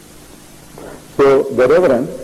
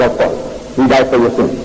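ordinary talk, nothing marked

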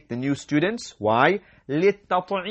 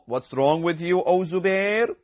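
ordinary talk

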